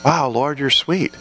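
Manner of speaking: soft, low wonder at the Lord; hushed awe with a small laugh; awed wonder. Retold or imitated. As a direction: marvel quietly, like a boy discovering him.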